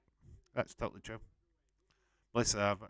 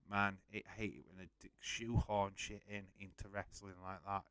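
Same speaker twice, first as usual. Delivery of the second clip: usual speaking voice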